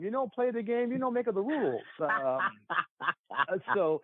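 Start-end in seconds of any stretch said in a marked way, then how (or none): 0.0-1.8 higher hammed-up Italian accent; jokey Italian accent; mock Italian accent. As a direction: quote dad's line in a bad Italian accent. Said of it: "I use that line all the time".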